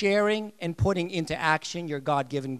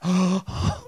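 gasp; 5 dB louder than usual. Retold or imitated. Mock shock at no marriage.

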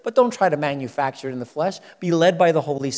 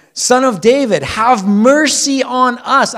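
crying out; 7 semitones higher, 6 dB louder, slower than usual. A narrator's reading. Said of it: "crying out and saying".

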